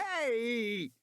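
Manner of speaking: sharp high yell while falling; high startled yell; startled shout. A narrator's reading. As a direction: cry out as you go down. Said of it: "Regis shouted as he fell".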